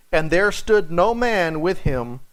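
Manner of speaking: ordinary delivery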